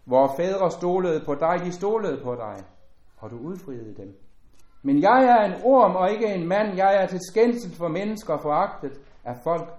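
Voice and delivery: solemn scripture reading. Acted. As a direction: lift the psalm out to the hall, solemn and full.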